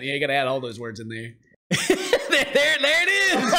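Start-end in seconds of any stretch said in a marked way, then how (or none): 1.7-3.6 high, slowed by real laughter; squeezed out through laughs; laughing. Real, not acted. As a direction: get it out while cracking up. Cracking up at the joke.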